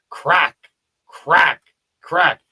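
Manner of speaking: loud single punched words, spaced by pauses; imitated bat cracks. Storyteller recreating echoes in an empty ballpark, loud punched cracks. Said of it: "you can hear in the echoes".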